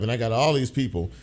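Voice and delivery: ordinary delivery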